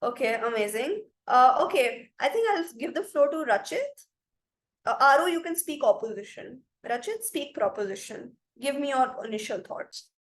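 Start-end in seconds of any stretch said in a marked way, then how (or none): none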